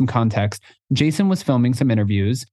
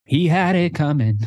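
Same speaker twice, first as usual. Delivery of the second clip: woman from Chicago; 7 semitones higher than usual. Retold or imitated. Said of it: "the woman from Chicago who's like".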